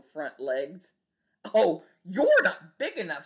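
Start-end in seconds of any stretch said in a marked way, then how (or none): 1.5-3.3 louder scornful brush-off; grouchy bug excusing itself; grouchy dismissal. Acted. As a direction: back down with a sneer. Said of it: "grouchy dismissal".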